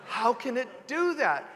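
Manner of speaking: lightly voiced child's innocent disbelief; puzzled young child. Three-year-old answering an upset parent about a bill, innocently puzzled. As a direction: ask it like a mildly baffled kid. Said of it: "the kid would be like".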